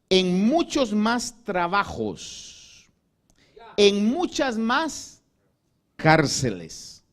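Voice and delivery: slow, loud, weighty preaching; solemn emphatic reading. Acted. Preacher declaiming Paul's list of sufferings, slow and loud. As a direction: let each hardship land slowly.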